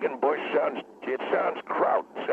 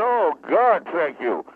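relieved; 4 semitones higher, 6 dB louder, slower than usual. Acted. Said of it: "I felt relieved".

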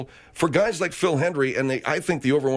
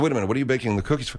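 rapid quote; 2 semitones lower, much faster than usual. Retold or imitated. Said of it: "I said".

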